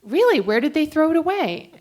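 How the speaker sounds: high, loud incredulous demand; fail comically at staying calm; loud, high-pitched disbelief passed off as calm; mock calm. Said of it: "I was pretty calm".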